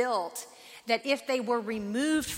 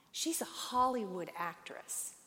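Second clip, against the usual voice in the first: ordinary delivery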